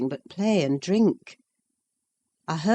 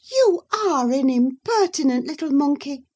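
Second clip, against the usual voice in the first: high, louder burst of astonishment; surprised exclamation; 9 semitones higher, 5 dB louder than usual. Acted. Catherine startled by Isabella's taunt, exclaiming high. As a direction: caught off guard, scolding. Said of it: "exclaimed Missus Linton in surprise".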